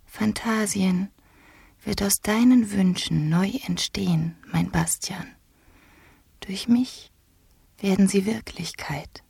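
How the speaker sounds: high, airy half-whisper; sweet, hushed and unhurried. A narrator's reading. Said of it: "hörte er die süße Stimme".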